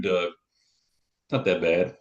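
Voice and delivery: slow, lightly voiced brush-off; dismissive brush-off. Someone telling Doug his trauma is no big deal, a slow mild brush-off. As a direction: drag it out like you're shrugging off his pain. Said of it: "imagine if somebody told you".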